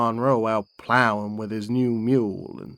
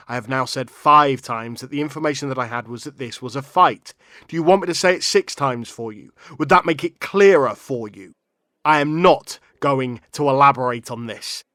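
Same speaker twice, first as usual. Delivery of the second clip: louder, higher, hammering each point home; fed up with being interrupted, push back hard; exasperated; 3 semitones higher, 7 dB louder than usual. Acted. Witness pushes back at the interrupting questioner, exasperated.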